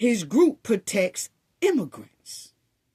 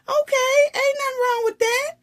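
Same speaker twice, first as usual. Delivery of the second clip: much higher, exclaimed acceptance; high-pitched acted reply; 3 dB louder, faster than usual. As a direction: shoot the pitch way up in mock exchange. Acted reply to reluctant admission of origins.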